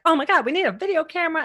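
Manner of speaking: high, loud and fast panic; frantic panic. Retold or imitated. Scrambling for a camera the night before, high frantic rush. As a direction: rattle it off in a flap.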